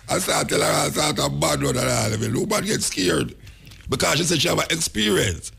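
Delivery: ordinary talk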